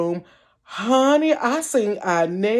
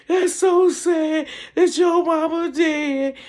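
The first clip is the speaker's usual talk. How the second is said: slow, high mock blubbering; bursting out crying; 9 semitones higher, slower than usual. Friend crying over her dead mama, blubbering. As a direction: imitate him wailing in sympathy. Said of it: "he bust out crying".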